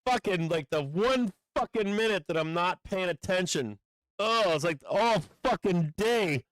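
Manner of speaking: real frustrated griping; exasperated. Real, not acted. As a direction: lament your terrible timing. Complains about missing the move.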